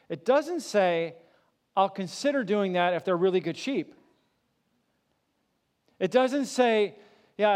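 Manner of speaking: full-force pulpit preaching, pleading with the crowd; impassioned preaching. Acted. Preacher proclaims the shepherd's words.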